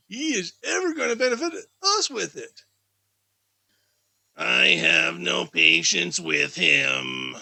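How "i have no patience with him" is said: a lower, slower, louder wife's voice; low slow niece. Acted. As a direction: drawl it out as the scornful wife.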